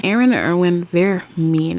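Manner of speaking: normal talking voice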